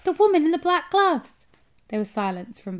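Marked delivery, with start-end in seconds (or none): 0.0-1.5 high, loud urgent shout. A narrator's reading. Boy telling grandmother about the woman, shouting. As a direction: holler the answer down from a height.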